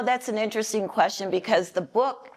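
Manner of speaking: ordinary talk, nothing marked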